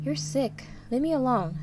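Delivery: high, loud cry of fear and disgust; frightened shout. A narrator's reading. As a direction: shout him away in fear. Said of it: "I shout".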